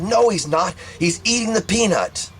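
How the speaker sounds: high-pitched, exasperated mother insisting; insistent denial. Retold or imitated. Mother dismissing her child's warning, imitated insistent denial.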